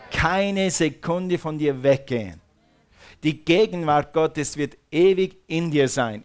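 loud, driving sermon delivery, pushing every phrase; full-force preaching. Acted. Preacher rousing his congregation about the Holy Spirit, loud and driving. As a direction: preach it out to the whole hall.

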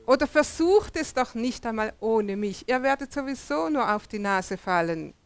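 ordinary delivery

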